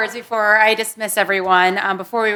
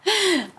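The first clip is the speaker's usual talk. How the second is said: light laugh; 9 semitones higher than usual. Real, not acted. Host laughs at remark.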